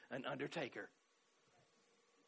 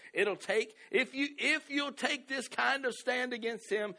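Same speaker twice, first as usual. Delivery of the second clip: slow, rising, louder preaching read; preaching scripture; 5 semitones higher, 4 dB louder than usual. Acted. Preacher pressing the verse on his church, slow and rising. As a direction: drive the verse home like a preacher.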